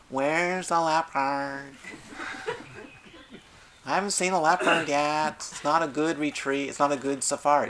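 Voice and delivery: a spoiled tourist demanding his leopard; bored tourist pestering the safari guide, whiny and demanding; bored safari goer. Retold or imitated. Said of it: "Imagine a bored safari goer".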